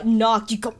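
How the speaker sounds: ordinary delivery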